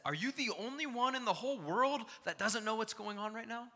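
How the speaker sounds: high, loud disbelieving question; mock disbelief at the one who doesn't know; incredulous question. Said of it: "spoke to Jesus and said".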